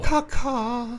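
Caca chirped high like a bird; chirping bird call. Shown as a demonstration. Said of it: "There you go".

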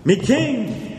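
high comic shout of a battle cry; mock dwarf shout. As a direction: bellow it like a silly dwarf hero. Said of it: "just shouting".

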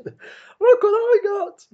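very high, loud slow squeal; gleefully showing off; excited squeal. Acted. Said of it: "Why would your voice change?".

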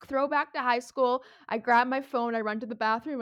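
loud, frantic retelling of the escape; loud flustered rush. Retold or imitated. Running off embarrassed, loud.

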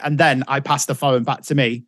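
usual speaking voice

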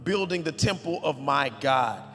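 loud, high preaching read; emphatic scripture reading. Acted. Pastor reading David's gifts to the church, booming. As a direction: declaim each treasure with weight.

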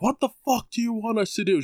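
high-pitched mock scream without full volume; retold screaming. Tenant retelling the blow-up at the landlady, mock screaming. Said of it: "So I just started screaming".

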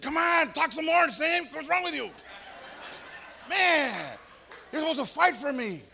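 high, loud urgent calling out; urgent plea. Retold or imitated. Pleading loudly with his lawyer in court. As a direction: push him to speak up for me.

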